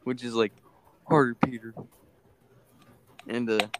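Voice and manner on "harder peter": light Ned impression; a hint of Ned. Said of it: "in his Ned voice".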